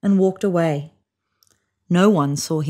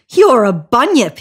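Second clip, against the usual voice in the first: platypus voice; 4 semitones higher, 7 dB louder than usual. A narrator's reading. Platypus answers.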